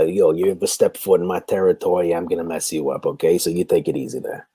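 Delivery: a New York wise guy warning you off his turf; Teddy Scrubs New York accent. Shown as a demonstration. A gruff New York accent, threatening a scammer on his turf. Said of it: "like, a, a New York accent".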